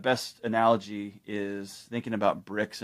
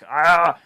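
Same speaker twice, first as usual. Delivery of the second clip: annoyed yelp; 7 semitones higher, 11 dB louder than usual. Retold or imitated. Husband pawing at her.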